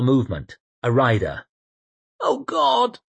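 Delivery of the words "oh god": ordinary delivery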